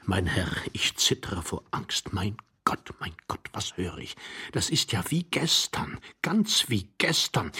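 frightened whisper; trembling with fear. Acted. Hidden man confiding his terror to his companion, fearful whisper. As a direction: whisper in mounting panic.

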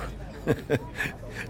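quiet breathy chuckle; soft laugh. Acted. Still amused by her companion's joke, quiet chuckle. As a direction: let the laugh trail off.

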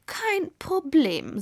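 lazily drawn-out nonchalance; casual. A narrator's reading. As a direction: toss it off at leisure. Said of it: "sagte Girundel lässig".

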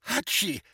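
high pitched sneeze; fake sneeze. Sneeze on sandwich.

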